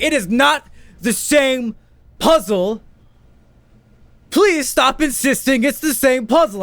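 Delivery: hammed-up furious teacher; teacher slamming the table, shouting at the child; mock angry shout.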